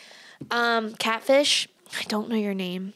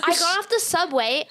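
normal talking voice

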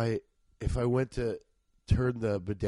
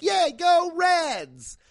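high, drawn-out stadium shout; mock fan cheer; 6 dB louder, much slower than usual. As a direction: cheer for the Reds at the ballgame. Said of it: "what if you're like".

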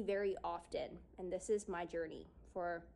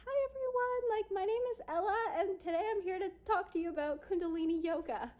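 much higher, fast nervous presenter; imitating a shaking voice; 10 semitones higher, 3 dB louder, faster than usual. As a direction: act out stage fright with a high, shaky voice. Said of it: "I could feel my voice just shaking".

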